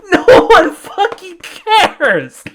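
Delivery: very high, drawn-out laughing yell; shrieking with laughter. Real, not acted. Ranting that nobody cares Barry is dead, in hysterical laughter. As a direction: howl it, barely getting the words out.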